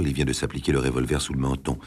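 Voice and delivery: ordinary delivery, nothing marked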